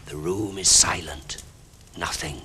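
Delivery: hushed narrator whisper. A narrator's reading. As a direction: whisper it into the silence.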